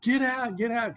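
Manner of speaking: very high-pitched mock yell; imitated scream. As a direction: imitate a panicked professor yelling to clear the room. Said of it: "of course, then again, he's like".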